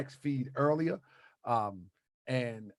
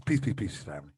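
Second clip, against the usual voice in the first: lightly put-on deep Pharaoh greeting; a hint of a solemn Pharaoh hailing the family; 8 semitones lower than usual. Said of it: "Let me do my Pharaoh voice".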